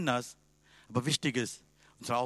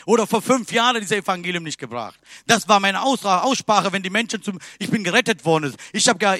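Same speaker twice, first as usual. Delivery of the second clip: loud, much higher, driving sermon exhortation; full-force preaching; 5 semitones higher, 6 dB louder than usual. Acted. Preacher urging his congregation to obey God, loud fervent preaching. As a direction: hammer the message home to the pews.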